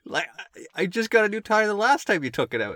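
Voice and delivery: high, loud disbelief; incredulous. Retold or imitated. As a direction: squawk out the outrage. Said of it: "I'm like".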